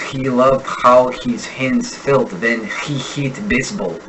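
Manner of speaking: slowly, Russian accent with a throaty scratched H on "He", "how", "his", "hands"; Russian scratchy H. Shown as a demonstration. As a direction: butcher the H like a Russian speaker. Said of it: "a Russian might butcher that last H-laden sentence".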